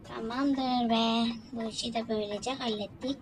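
ordinary talk, nothing marked

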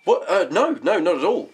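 high, hasty denial; flustered eagerness. Acted. Man invited by his ex denies being busy, flustered.

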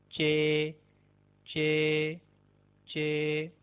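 "चे" with a ch sound as in chain; Turkish Ç. Shown as a demonstration. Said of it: "चेन के अंदर सी एच". ("चे" चेन वाली आवाज़ में)

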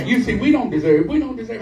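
ordinary talk

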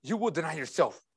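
hushed solemn repeat. Real, not acted. Preacher lets the charge sink in for believers, hushed. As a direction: bring it right down, quiet and grave. Same words repeated softly.

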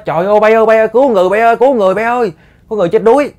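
high, loud shout of alarm; la lên. Retold or imitated. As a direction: yell to the crew that someone is drowning. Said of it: "Thì ổng mới la lên". (la lớn báo động, giọng cao)

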